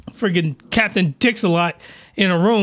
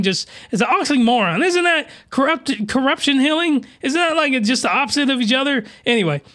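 ordinary delivery

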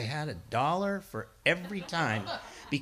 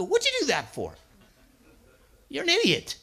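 imitating a friend, louder and higher, scornful; mock scolding; 10 semitones higher, 6 dB louder than usual. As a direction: scoff at a foolish friend.

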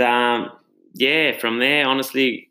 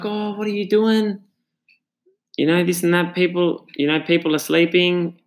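higher-pitched mock rant of a woman in a nightgown; irate old lady; 7 semitones higher than usual. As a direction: imitate a lightly voiced, upset elderly neighbour. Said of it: "she was just going off. She was freaking out".

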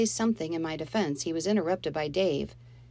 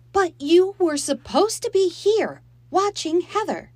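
much higher and louder, reproachful mother; scolding mother; 13 semitones higher, 9 dB louder than usual. Acted. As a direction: scold her for leaving. Mom scolding her daughter.

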